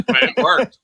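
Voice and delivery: words squeezed out through a big laugh. Real, not acted. Laughing at his own joke.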